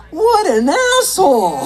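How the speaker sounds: high, drawn-out indignant cry; mock outrage. Comedian hearing his friend was cheated, high indignation.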